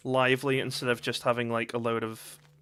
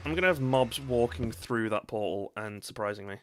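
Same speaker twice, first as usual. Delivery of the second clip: ordinary talk, nothing marked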